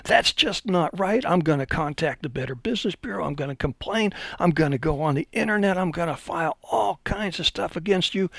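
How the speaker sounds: high, angry mock outrage; yelling customer. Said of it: "He starts yelling and screaming".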